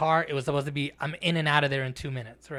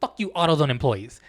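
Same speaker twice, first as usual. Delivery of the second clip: emphatic objection; 2 semitones higher, 9 dB louder than usual. Real, not acted. Host overruling his cohost, loudly emphatic. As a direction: cut in with a big raised no.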